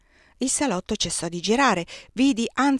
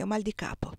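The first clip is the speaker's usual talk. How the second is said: ho mal di capo whispered, much softer, barely voiced; weary whisper; 8 dB quieter than usual. A narrator's reading. Désirée pleads a headache to escape the guests, whispering. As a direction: let "mal di capo" fade into breath. Désirée excuses herself, unwell.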